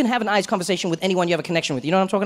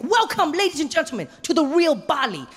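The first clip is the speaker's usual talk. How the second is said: grand guide; 8 semitones higher than usual. Retold or imitated. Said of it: "he's like".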